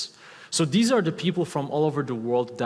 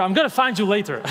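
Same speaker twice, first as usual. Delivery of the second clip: playful mock threat, higher and louder; 7 semitones higher, 6 dB louder than usual. Joking at the audience laughing at his teenage photo, mock threat. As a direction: tease the laughing crowd with a fake warning.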